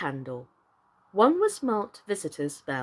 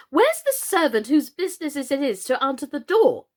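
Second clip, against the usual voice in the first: higher and louder, indignant demand; angry; 6 semitones higher, 5 dB louder than usual. A narrator's reading. Alice demands the doorkeeper, angry and louder. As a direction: snap at whoever should answer. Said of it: "she answered angrily".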